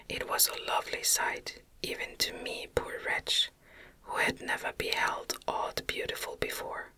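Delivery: plain reading voice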